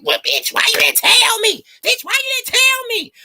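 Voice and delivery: high squeaky mock voice of an annoyed friend; hammed-up high friend voice, miffed; mock scolding friend. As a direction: squeal it like a friend who feels left out. Friend T scolding her, mocked.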